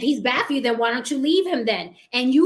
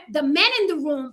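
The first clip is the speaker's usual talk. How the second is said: normal talking voice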